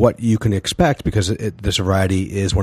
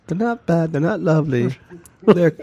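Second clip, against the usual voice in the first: high, prim English lady; British lady voice; 10 semitones higher than usual. Acted. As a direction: mimic a refined British lady.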